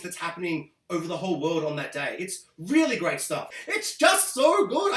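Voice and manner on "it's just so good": high, slow and squealing with joy; gushing delight. Real, not acted. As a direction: swoon over "good" up high. Gushing about the episode.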